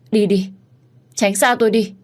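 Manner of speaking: high, loud frightened cry; panicked shout. Acted. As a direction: shriek to drive the stranger away. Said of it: "Cô bị sốc thần kinh". (cô gái hoảng loạn hét đuổi người lạ)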